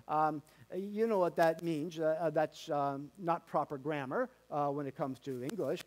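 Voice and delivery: usual speaking voice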